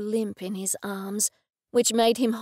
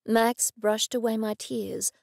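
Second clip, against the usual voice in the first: plain reading voice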